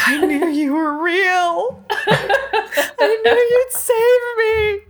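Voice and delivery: high, slow, laughing through tears; teary laughing. Acted. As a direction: sob out the thanks while giggling. Character cries in gratitude.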